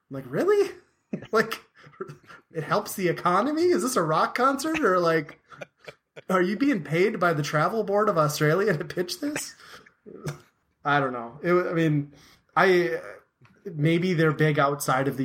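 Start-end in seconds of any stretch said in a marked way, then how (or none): 0.3-9.5 high, mocking disbelief with a laugh; incredulous sarcasm. Fan mocking the singer's tourism pitch, sarcastic disbelief. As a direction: scoff at him like it's absurd.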